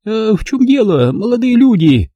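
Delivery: put-on high old woman gumming the words; toothless mumble. A narrator's reading. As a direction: mumble like an old lady without teeth. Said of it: "прошамкала она".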